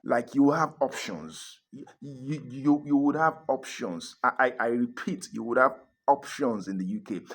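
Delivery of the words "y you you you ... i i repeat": usual speaking voice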